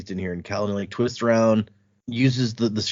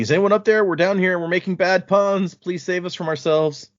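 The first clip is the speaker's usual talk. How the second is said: retold yelling; 7 semitones higher than usual. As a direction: call up to whoever might be above, half-yelled. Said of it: "he starts yelling".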